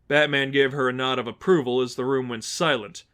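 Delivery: usual speaking voice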